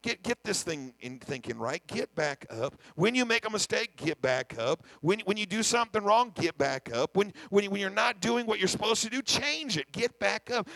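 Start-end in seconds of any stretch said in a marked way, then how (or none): 1.8-10.7 call-and-answer cadence; preaching refrain. Acted.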